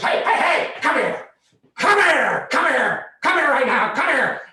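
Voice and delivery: high, loud repeated commands; frantic, furious hollering; ranger yelling. Retold or imitated. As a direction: shout after someone getting away. Ranger yelling after fleeing cyclists.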